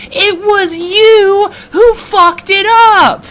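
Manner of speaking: high, loud, drawn-out furious yell; angry outburst. Real, not acted. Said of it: "really makes me angry".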